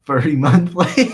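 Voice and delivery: loud burst collapsing into laughter; laughing loudly. Real, not acted. Streamer reading a chat joke aloud, laughing loudly. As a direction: blurt it out, cracking up.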